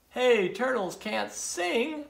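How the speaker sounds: high, drawn-out storybook shout; mild, stretched-out heckle from afar; shouting katydid. A narrator's reading. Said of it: "a katydid shouted".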